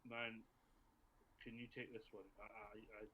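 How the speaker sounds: telepathic aside. Acted. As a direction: say it softly, for one mind only. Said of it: "maintained the telepathic chat".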